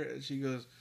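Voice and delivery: ordinary talk, nothing marked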